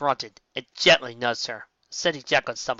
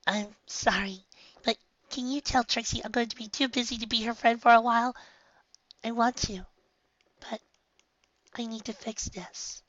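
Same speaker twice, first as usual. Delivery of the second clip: soft, higher, sad and hushed; quiet apology; 9 semitones higher, 6 dB quieter than usual. Acted. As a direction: turn her down gently, regretfully.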